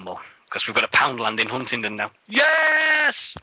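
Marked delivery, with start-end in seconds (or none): 2.3-3.4 excited shout. Real, not acted. Cheering good news of Poundland.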